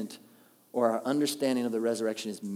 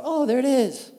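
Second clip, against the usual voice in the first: mock realization; 12 semitones higher, 7 dB louder than usual. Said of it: "you're gonna go".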